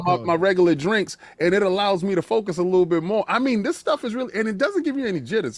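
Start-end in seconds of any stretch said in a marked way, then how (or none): 2.8-5.6 ad read. Acted. Sponsor ad read.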